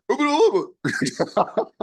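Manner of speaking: high, slow laughing echo; cracking up while repeating it. Real, not acted. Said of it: "he got all that out of".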